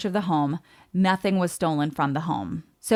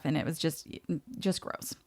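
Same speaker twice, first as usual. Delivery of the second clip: disgusted whisper; 2 semitones lower, 11 dB quieter than usual. Real, not acted. Disgust trailing off.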